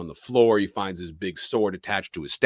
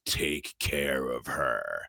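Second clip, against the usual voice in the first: low, slow movie-hero voice; Hellboy; 5 semitones lower, slower than usual. Acted. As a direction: play a gruff hero's slow, solemn parting words. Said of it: "Hellboy puts down Liz and tells Myers".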